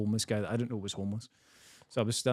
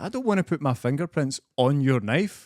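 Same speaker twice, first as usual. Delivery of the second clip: slow, louder, higher-pitched protest; retold incredulous refusal, drawn out; emphatic refusal; 4 semitones higher, 6 dB louder than usual. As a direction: spell it out in mock disbelief. Retold refusal to take the knife.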